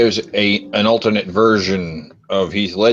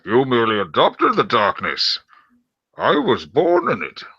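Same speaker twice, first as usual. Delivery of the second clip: swooping, theatrical villain impression; put-on voice with pitch leaping on key words; Bane; 3 semitones higher than usual. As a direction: declaim it like a masked movie villain. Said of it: "I want you to do your Bane voice".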